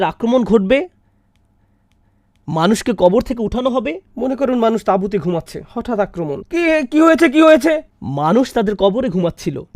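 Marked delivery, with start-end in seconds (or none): 6.4-7.8 high, panicked confusion, much higher; jolted awake, asking what happened; startled waking. Acted. Sleepers startled awake by attack. (হঠাৎ ঘুম ভেঙে আতঙ্কিত প্রশ্ন)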